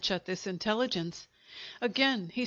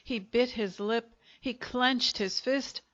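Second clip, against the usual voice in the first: ordinary delivery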